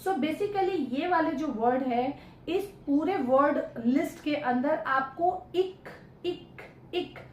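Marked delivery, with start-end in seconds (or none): 5.5-7.2 "इक" repeated as a separate short sound, pause after each; sound demonstration. Shown as a demonstration. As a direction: demonstrate the ending "इक" with a crisp final k. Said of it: "ऐसा sound".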